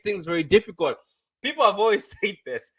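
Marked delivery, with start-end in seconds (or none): 1.4-2.7 laughing. Real, not acted. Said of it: "I don't know why I'm laughing".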